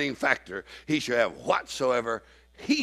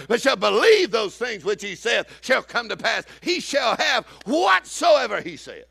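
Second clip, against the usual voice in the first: slow, loud, high preaching of scripture; 9 semitones higher, 3 dB louder, slower than usual. Acted. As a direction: thunder the verse out slowly like a revival preacher. Preaching scripture at full force.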